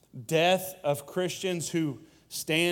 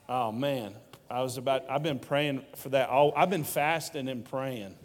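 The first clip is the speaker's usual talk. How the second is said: usual speaking voice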